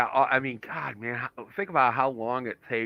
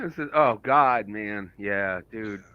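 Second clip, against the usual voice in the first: normal talking voice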